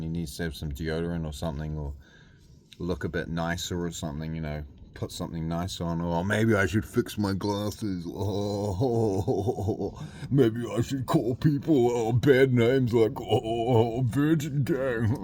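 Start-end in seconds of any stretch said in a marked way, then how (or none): 6.0-15.2 slow, sneering mock voice; lightly voiced parody of the rival; mock a smug guy calling fans names. Mocking rival's voice.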